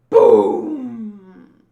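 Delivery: loud imitated boom; mock explosion boom. Voicing the explosion, a loud mock boom. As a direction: shout the boom like a blast.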